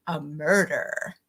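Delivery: lightly ominous, drawn-out murder; sinister murder. Pretend. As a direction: linger on murder like a spooky narrator. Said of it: "say murder in a sinister British accent".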